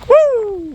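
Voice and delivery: high joyful woo; excited whoop. Real, not acted. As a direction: let out a loud celebratory whoop. Cheering the end of hike.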